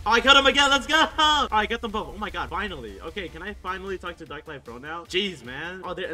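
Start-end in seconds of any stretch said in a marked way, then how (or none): 0.1-2.1 loud, high celebration; triumphant shout. Real, not acted. Gamer celebrates getting both opponents, excited shouting. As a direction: cheer wildly at the win.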